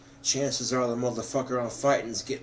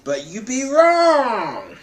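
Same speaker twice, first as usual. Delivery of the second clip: much higher, louder and slow, a mock-grand declaration; theatrical high shout delivering the punchline; hammed-up exclamation; 12 semitones higher, 11 dB louder, slower than usual. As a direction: belt it out like a cartoon announcer. Mock-dramatic punchline on his abs.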